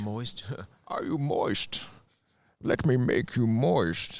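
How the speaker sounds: Bane voice. Pretend. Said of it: "turned on by Bane".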